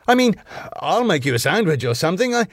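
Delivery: high, quick, flustered offer; hasty backtracking. A narrator's reading. Husband retreats before his wife, flustered. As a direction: start high, then hurry to placate.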